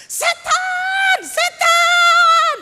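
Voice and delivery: high, very loud shriek of "Setan"; terrified shout. Acted. Tribesman screams at the stranger pulling out his teeth, shrieking. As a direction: scream it like you saw a ghost. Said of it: "anggota suku ini teriak".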